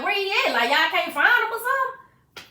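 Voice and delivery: high, loud, desperate questioning; frantic demand. Retold or imitated. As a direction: plead loudly for someone to find him. Retelling frantic questions about brother.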